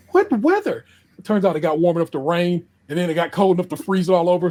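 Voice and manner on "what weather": high, incredulous what weather; retold disbelief. Host retelling the school closure call, incredulous. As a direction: pitch it way up in mock disbelief. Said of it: "and I'm like".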